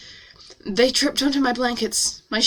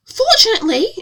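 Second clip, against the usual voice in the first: put-on high, loud, chirpy British accent; cheerful British accent; 12 semitones higher, 5 dB louder than usual. A narrator's reading. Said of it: "she said cheerfully in a lovely British accent".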